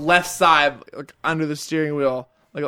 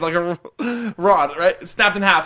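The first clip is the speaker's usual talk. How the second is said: ordinary delivery, nothing marked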